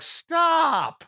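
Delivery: high-pitched, drawn-out mock yell; exaggerated, high exasperated outburst; exasperated shout. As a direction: cry out in mock agony at a bad performance. Exasperated reaction to bad accent.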